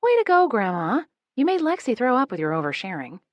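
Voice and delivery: a sister chiding sharply; chastising. A narrator's reading. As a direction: scold grandma for oversharing. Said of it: "Peyton chastises".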